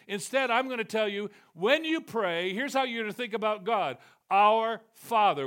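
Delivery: loud, high preaching at full force; fiery preaching. Acted. Preacher to congregation, voicing God in a loud ringing sermon.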